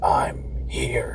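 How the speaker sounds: low, whispered growl. Retold or imitated. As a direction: growl it out like a spirit on tape. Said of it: "in a growling voice".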